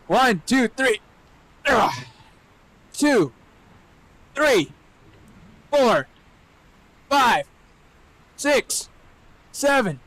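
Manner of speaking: high, effortful counting between push-ups. Real, not acted. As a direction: strain out each number.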